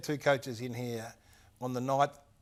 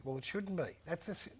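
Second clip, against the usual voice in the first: usual speaking voice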